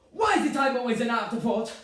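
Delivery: loud, high, wounded complaint; aggrieved mother. Acted. Mother complaining to her son, aggrieved. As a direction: accuse him of neglect, loudly.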